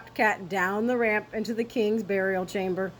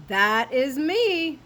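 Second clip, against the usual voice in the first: slow, proud boast; proud declaration; 4 semitones higher, much slower than usual. A narrator's reading. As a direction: announce himself grandly. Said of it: "declared Scipito".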